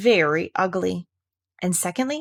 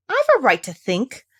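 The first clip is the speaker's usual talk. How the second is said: sharp; 6 semitones higher, 4 dB louder than usual. Acted. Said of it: "said Alice sharply".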